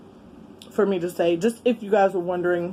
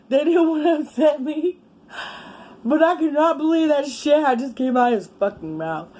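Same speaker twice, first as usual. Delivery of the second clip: laughing; 8 semitones higher than usual. Real, not acted. Laughing retelling shock.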